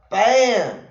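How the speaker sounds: a loud, high "Bam!" for the slap; slap sound effect. Retold or imitated. Retelling the slap to viewers, a loud mock "Bam!". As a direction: punch out the smack like a sound effect.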